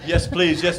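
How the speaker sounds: ordinary talk, nothing marked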